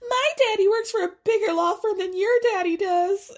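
put-on high whiny bratty kid voice; mock him like a spoiled kid. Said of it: "Ew, what are we doing?".

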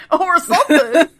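high, loud, laughing repeat; words bursting out through laughter; laughing echo. Real, not acted. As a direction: crack up while echoing it. Laughingly echoes co-host's phrase.

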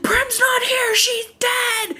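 very high squealed mock scream; mock yelling. Katniss yelling at Buttercup the cat, high-pitched mock yell. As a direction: squeal it out, imitating a distraught yell. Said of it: "she's, like, yelling at Buttercup".

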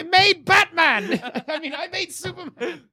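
gleeful mad boast. Pretend.

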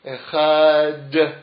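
Echad drawn out long on the final sound; stretched Echad. Shown as a demonstration. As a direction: stretch out Echad slowly to its last breath. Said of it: "stretching out the Dalit of Shema".

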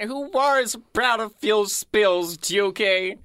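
loud, high American drawl for Smudger; brash American engine voice; American accent. Acted. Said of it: "he's got this weird American accent".

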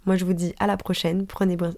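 normal talking voice

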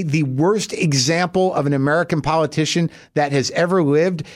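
ordinary talk, nothing marked

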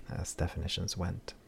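usual speaking voice

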